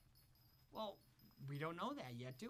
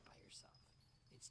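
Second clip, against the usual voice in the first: whispering; 16 dB quieter, faster than usual. Real, not acted. Host drops to a whisper.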